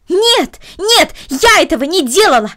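high, loud shouted no. A narrator's reading. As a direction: cry out against them even harder. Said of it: "ещё громче протестовала Корнели".

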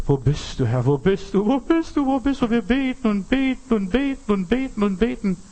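climbing into a high chanting plea; mock-pleading, pitched far up and repetitive; mimicked desperate prayer. Preacher mimics people praying to a God far away, high pleading.

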